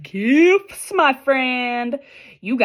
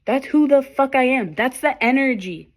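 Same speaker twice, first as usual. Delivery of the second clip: ordinary talk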